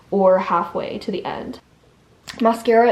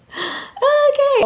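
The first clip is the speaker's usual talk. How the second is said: startled high okay; jolted by the clock; surprised; 4 dB louder than usual. Real, not acted. Realises the time.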